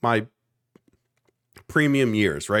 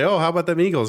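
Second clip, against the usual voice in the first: eager stranger; 7 semitones higher, 4 dB louder, faster than usual. Retold or imitated. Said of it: "And then they say".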